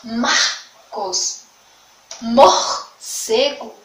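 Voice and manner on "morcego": "Morcego" very slow and loud, the middle R rasped; drawn-out R demo. Shown as a demonstration. Teacher spelling out "Morcego" loudly for pupils. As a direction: stretch "Morcego" syllable by syllable, rasping the R. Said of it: "No meio".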